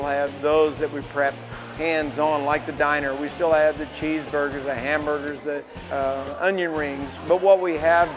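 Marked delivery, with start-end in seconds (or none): none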